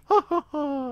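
put-on high cackling old hag laugh; witch laugh. Shown as a demonstration. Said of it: "that's my witch laugh".

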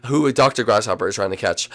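ordinary talk

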